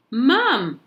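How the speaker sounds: put-on high, loud child's yell; boy yells for mum at the barber's. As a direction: call mum as loud as a kid can. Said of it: "I yelled".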